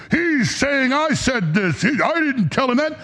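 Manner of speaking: high, slow, whiny mock voice of a rival; mocking Bongino. Host mocks Bongino denying the scoop, high whiny mock voice. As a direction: sneer at him in a put-on whine. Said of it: "Bongino got pissed ... and went".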